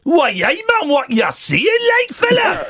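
hammed-up Geordie, shouting high on fella; a terrible mock Newcastle accent, why hey man; bad Geordie accent. Host doing his Geordie for a caller, terrible Geordie accent. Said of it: "your Geordie accent is the worst I've ever heard".

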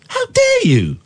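shrill high falsetto cry of offence; mock outrage. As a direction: screech it like a wounded diva.

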